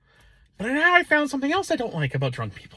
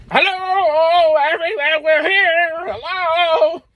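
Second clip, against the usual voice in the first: calling out loud; much slower than usual. Retold or imitated. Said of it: "You don't have to yell".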